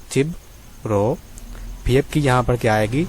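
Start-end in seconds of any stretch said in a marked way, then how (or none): 0.1-1.1 چبرو drawn out very slowly, syllable by syllable; slow demo. Shown as a demonstration. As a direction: stretch each sound of چبرو for the learner. (چبرو کو بہت آہستہ، ایک ایک حصہ کھینچ کر)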